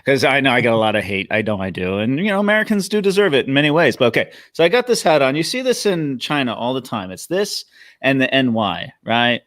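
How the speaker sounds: ordinary talk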